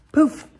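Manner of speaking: high, loud "Poof!"; storyteller popping the magic sound, high and loud. Acted. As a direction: burst it out like a magic trick. Narrator voices magic effect.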